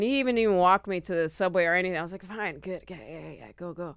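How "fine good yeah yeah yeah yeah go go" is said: low, quick-fire, trailing dismissal; lightly acted, soft shooing away; muttered brush-off. Brushing off a date who won't walk them, muttered brush-off.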